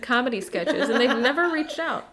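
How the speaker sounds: laughing, slow, high-pitched mock whine; imitating friend. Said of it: "She's like".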